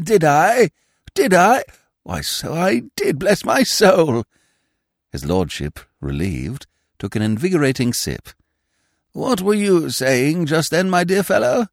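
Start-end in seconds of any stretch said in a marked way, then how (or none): 0.1-4.2 put-on high, fluttery voice of a vague old lord; a dithering aristocrat caught off guard, pitched high; flustered old earl. Vague old earl startled by his secretary, high and flustered. 9.1-11.7 put-on high, fluttery voice of a vague old lord; a dithering aristocrat caught off guard, pitched high; flustered old earl. Vague old earl startled by his secretary, high and flustered. Lord Emsworth asks Baxter.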